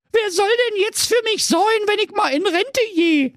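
blubbering. A narrator's reading. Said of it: "heulte sie".

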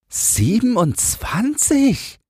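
put-on very high, slow, drawn-out child's wonder; round-mouthed amazement. A narrator's reading. Boy hears how many rabbits there are, astonished. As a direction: stretch the number out in boyish astonishment. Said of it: "machte einen runden Mund".

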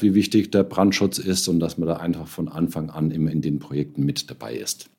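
ordinary talk

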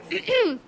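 throat clearing after choking. Real, not acted. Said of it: "Sorry, hab ich was verschluckt".

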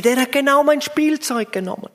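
preacher imitating an upset little girl. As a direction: whine like a little girl whose toy was taken. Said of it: "Und dann sagt sie".

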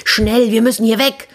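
high, frightened urgent cry; panicked thief. Acted. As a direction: blurt it out in a panic. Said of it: "Da schrie der Dieb auf".

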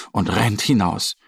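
plain reading voice

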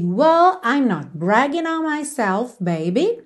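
"Whoa" said as wo, with no h; whoa, silent h. Shown as a demonstration. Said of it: "você fala whoa".

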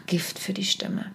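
whispered "einfach Gift für die Stimme". Shown as a demonstration. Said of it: "Aber Flüstern ist". ("einfach Gift für die Stimme" geflüstert)

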